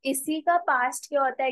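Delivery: ordinary delivery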